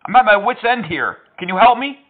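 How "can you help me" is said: ordinary delivery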